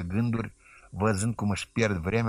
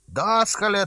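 \"Dascăle\" yelled much higher and louder, mocking; loud taunting shout; 9 semitones higher, 5 dB louder than usual. A narrator's reading. Cheeky neighbour boy jeers the deacon, loud mocking shout. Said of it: "zise cu glas mare".

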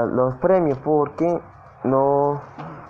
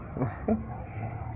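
breathy laugh; 4 semitones higher, 13 dB quieter than usual. Real, not acted. Laughs at his bad luck.

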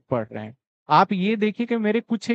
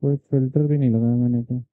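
cave echo; 6 semitones lower than usual. Real, not acted. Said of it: "इको आ रही है।".

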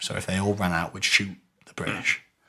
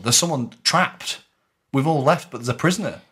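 urgent report; 5 semitones higher than usual. Retold or imitated. Retold urgent news of a prisoner.

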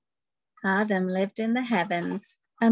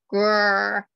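louder playful growl; gorilla growl; 5 dB louder than usual. Acted. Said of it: "growled the gorilla".

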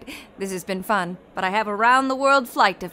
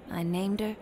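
whispering; 5 semitones lower, 10 dB quieter than usual. Acted. Amelia fondly recalls her plane.

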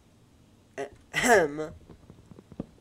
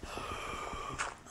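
gasp; 4 dB quieter than usual. Real, not acted. Gasp at discovery.

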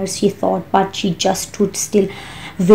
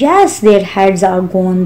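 ordinary delivery, nothing marked

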